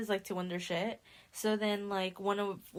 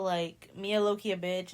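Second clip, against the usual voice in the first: usual speaking voice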